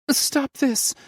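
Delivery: high, loud pleading mother. A narrator's reading. Mother pleading with her husband on the stairs. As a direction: beg him to stop. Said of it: "Mother pleaded".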